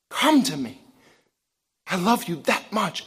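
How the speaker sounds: a quiet lover's call; tender plea. Acted. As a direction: coax them home gently. God wooing his people through Hosea.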